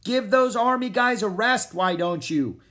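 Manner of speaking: high, loud teasing yell. A narrator's reading. Annie calling after the departing prince, high loud yell. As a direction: yell it after someone riding away. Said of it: "yelled Annie".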